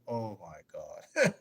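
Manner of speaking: slow, drawn-out exclamation breaking into laughter; amused dismay. Real, not acted. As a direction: drag it out, then crack up. Mock dismay at imagined presidency, laughing.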